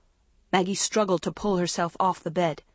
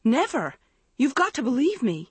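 high, desperate plea; frantic denial; crying out; 7 semitones higher than usual. A narrator's reading. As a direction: insist urgently, pitch climbing. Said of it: "Andrea cried".

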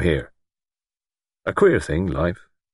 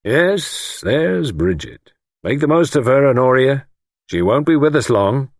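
raised, louder mock joviality; chuckling lord; 7 semitones higher, 4 dB louder than usual. A narrator's reading. Lord teases older woman about her visitor, chuckling. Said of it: "Lord Whitfield chuckled".

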